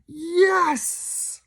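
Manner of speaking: very high screaming crowd cry. Acted. Crowd screaming back at the host, very high. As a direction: shriek it like a fan mob.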